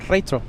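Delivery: "Retro" said the German way, like "ray-toh"; "Retro" with a long "ay" vowel, German style; German pronunciation. Shown as a demonstration. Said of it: "You gotta say it like a German".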